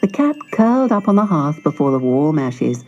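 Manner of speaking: usual speaking voice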